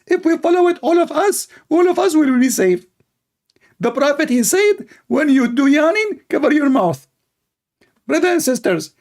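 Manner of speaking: high, earnest mock preacher; Sabil Ahmed preacher impression. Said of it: "his name is Doctor Sabil Ahmed".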